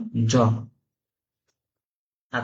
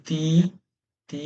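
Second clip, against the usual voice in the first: ordinary delivery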